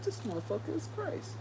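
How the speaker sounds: high, soft and fast, half under the breath; mocking disbelief. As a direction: scoff at him in a quick high hush. Imagined reaction to a stranger.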